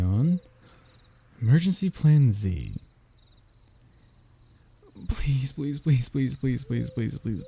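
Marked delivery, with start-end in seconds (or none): 5.1-7.4 mock begging. Begging the dragon repeatedly.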